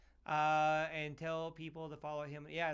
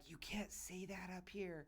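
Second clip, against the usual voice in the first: slow, much softer mock admonishment; hushed reproach; 2 semitones higher, 12 dB quieter, slower than usual. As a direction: imitate people quietly scolding, slowly. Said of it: "people were like".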